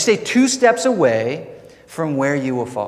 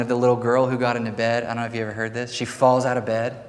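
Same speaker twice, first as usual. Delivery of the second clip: ordinary talk, nothing marked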